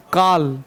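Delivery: "काल" with an open long aa instead of "कॉल"; imitating Allahabad accent. Shown as a demonstration. Teacher mocks local way of saying "काल" for call. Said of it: "कॉल को काल बोलते हैं".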